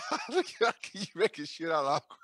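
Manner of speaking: laughing. Real, not acted. Repeating the line while laughing.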